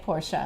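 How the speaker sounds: "Porsche" as POR-sha; two-syllable Porsche. Shown as a demonstration. Co-host learning the European way, Porsche as POR-sha. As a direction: add an "uh" to the end of "Porsche". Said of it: "I'll learn to say Porsche".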